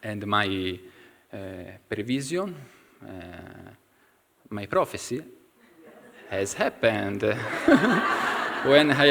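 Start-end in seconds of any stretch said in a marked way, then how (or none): none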